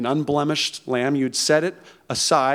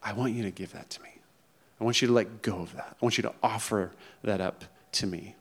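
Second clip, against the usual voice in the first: soft, quicker, low-key voice of God; God speaking gently; 5 semitones lower, 8 dB quieter, faster than usual. Pretend. God gently asking a listener to let go, soft. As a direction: let God's call come soft and near. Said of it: "God is through today saying".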